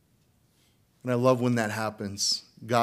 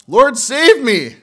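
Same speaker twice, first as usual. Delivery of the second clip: louder, higher, slow acted cry of Lord, save me; cry for help; 12 semitones higher, 13 dB louder, slower than usual. Peter sinking, crying to Jesus, acted loud cry. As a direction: call out like a man going under. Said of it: "what did he cry out".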